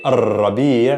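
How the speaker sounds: "ar-rabi'" with a long rolled R; strong R. Shown as a demonstration. Arabic teacher gives strong R example, long trill. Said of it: "that's a strong R".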